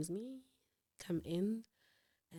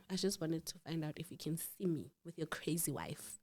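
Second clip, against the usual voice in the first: snide whispered aside under the breath; 3 semitones lower, 13 dB quieter than usual. Retold or imitated. Mocking the man to the caller, whispered aside. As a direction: hiss it sideways so only the listener hears. Catty aside about his wife.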